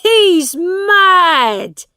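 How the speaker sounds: high, very loud exclamation; shouting. A narrator's reading. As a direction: yell it out, pitched high. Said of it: "shouted John Canty".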